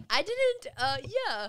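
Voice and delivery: high, loud mocking imitation. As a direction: mimic his flustered answer up high. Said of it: "He was like".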